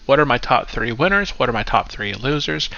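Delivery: usual speaking voice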